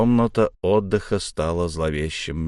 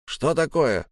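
higher, mildly worried question; understated concern, pitched up; alarmed; 6 semitones higher, slower than usual. A narrator's reading. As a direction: ask with a touch of alarm. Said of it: "встревожился Яки".

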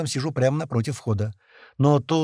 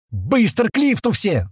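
high, slow, drawn-out shout; full-throated yell; 7 semitones higher, 4 dB louder than usual. A narrator's reading. Ordering everyone to the lift, yelling. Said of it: "ору во всё горло".

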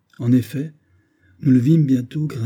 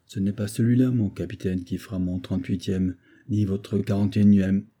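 plain reading voice

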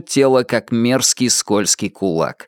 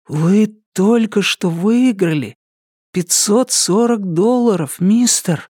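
put-on high, drawn-out teenage wonder; awed reverence; 8 semitones higher, slower than usual. A narrator's reading. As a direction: say it in hushed awe, pitched up. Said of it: "Тихо и благоговейно проговорил подросток".

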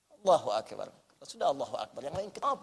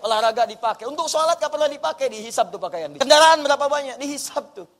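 rising, pleading cry to God, louder on ya Allah; fervent prayer; 3 semitones higher, 7 dB louder than usual. Acted. Preacher voicing a repentant believer praying, pleading. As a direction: pour out a confession with growing urgency.

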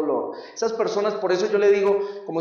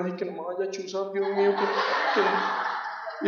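much softer, laughing, mock terrified; scared inner voice; 6 dB quieter than usual. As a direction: play the scared kid inside, hushed. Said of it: "yo por dentro era". (voz interior asustada, muy bajita y riéndose)

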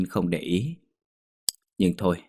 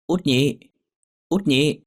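high, raised call to a friend; hailing someone on the road; 7 semitones higher, 4 dB louder, slower than usual. A narrator's reading. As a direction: call her name across a distance. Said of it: "Có tiếng ai đó kêu mình".

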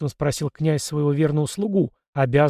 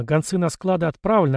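ordinary delivery

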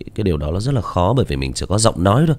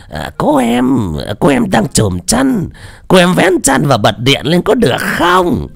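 put-on much higher, slow, leering old lecher voice; giọng Lão Quách; 11 semitones higher, 4 dB louder, slower than usual. Shown as a demonstration. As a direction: drawl it high like a dirty old man. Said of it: "thì ví dụ như Lão Quách này". (giọng ông già dê xồm cao vút, chậm rãi)

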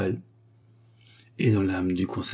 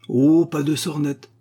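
slow, higher, louder scoff; dismissive; 7 semitones higher, 7 dB louder, slower than usual. Acted. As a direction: brush off his nonsense. Mummy dismisses curator.

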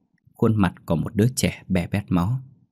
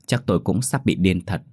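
ordinary delivery, nothing marked

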